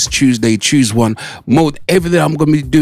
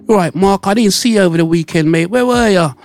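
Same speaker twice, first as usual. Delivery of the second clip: higher, bright Cockney greeting to a mate; cheery mate greeting; 6 semitones higher than usual. Shown as a demonstration. Host acting a mate greeting Mark, higher and cheery. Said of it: "we're gonna use Mark".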